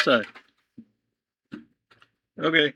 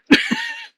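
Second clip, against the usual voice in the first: shrill shocked laugh; 5 dB louder than usual. Real, not acted.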